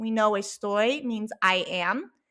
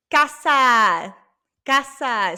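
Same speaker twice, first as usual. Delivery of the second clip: loud model word; 2 semitones higher, 7 dB louder, much slower than usual. Shown as a demonstration. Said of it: "Say it with me".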